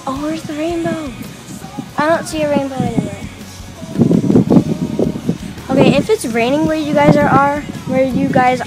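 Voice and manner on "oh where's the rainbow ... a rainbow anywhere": ordinary talk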